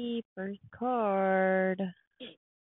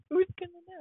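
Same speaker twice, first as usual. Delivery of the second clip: laughing; 11 semitones higher, faster than usual. Real, not acted. Wife teasingly echoes husband's boast.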